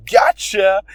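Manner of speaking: spring it on the class; triumphant gotcha. Retold or imitated. Student springing the prank on the teacher, triumphant shout. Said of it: "I say".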